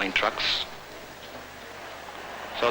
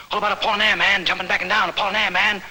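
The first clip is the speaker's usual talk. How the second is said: heated boasting; 11 semitones higher, 5 dB louder, faster than usual. Retold or imitated. Poet boasting in a heated argument.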